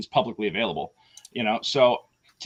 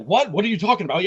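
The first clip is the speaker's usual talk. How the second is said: mock shock; 6 semitones higher, 4 dB louder, faster than usual. Caller shocked on the phone.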